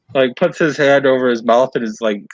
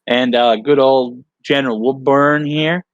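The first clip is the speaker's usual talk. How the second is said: put-on Southern accent; 2 semitones higher than usual. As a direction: play a folksy Southern right-hand man. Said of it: "I'm gonna stick with Southern".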